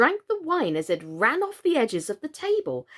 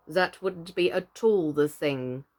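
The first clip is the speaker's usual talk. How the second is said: low, slow, firm decision; decided queen; 6 semitones lower than usual. A narrator's reading. Red Queen overruling Alice, firm and low. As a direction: settle it with a lower voice. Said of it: "said the Red Queen decidedly".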